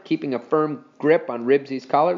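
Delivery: usual speaking voice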